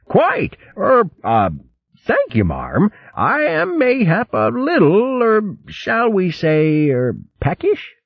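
high, dithering, hemming and hawing; fussy old hare hinting he is hungry; stammering old hare. Acted. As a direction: bluster politely while angling for food. Hare admits hunger.

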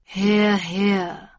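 an unhurried interjection from a hidden newcomer; voice from shadows. A narrator's reading. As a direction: drop in coolly from the back of the room. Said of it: "said a voice from the shadows".

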